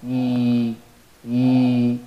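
ee held long from the middle of the mouth. Shown as a demonstration. Teacher demonstrating the long ya sound. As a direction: stretch the ee sound. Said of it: "then it has a stretched voice".

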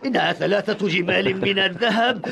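normal talking voice

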